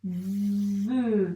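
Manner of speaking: Ve articulé clairement; dictation syllable. Shown as a demonstration. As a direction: poser la syllabe Ve. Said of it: "il nous manque ensuite le". (syllabe Ve bien articulée)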